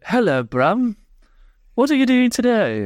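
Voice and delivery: put-on high, silly kids' TV voice; hammed-up children's show presenter; ridiculous cartoon voice. Imitating a kids' show character greeting Brum, silly and high. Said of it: "really ridiculous voices".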